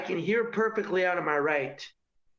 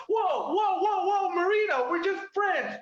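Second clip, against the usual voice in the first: mock girl shouting; 11 semitones higher than usual. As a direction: shriek it like a startled girl friend-zoning him. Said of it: "They're like".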